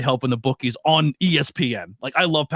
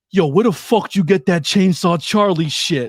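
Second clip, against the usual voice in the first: higher, louder gruff wrestler voice; horrible Nick Gage impression; 6 semitones higher, 7 dB louder than usual. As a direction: play the rough wrestler, badly. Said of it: "I'm gonna do a horrible impression".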